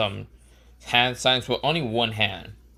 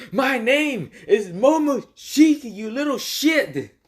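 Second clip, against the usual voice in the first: high, loud, drawn-out enraged yell; screaming; 13 semitones higher, 5 dB louder, slower than usual. Acted. Momoshiki raging inside his host's mind, screaming. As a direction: bellow each word slowly at a high pitch. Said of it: "Momoshiki screams in his mind".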